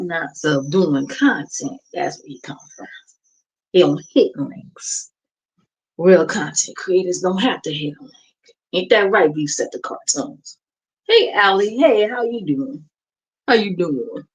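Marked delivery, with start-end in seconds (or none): none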